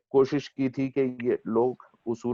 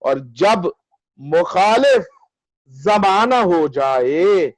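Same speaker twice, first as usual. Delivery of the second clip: measured, higher mushaira declamation with lengthened vowels; ghazal recitation; 7 semitones higher, 5 dB louder, slower than usual. Acted. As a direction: recite the verse grandly, drawing out each phrase.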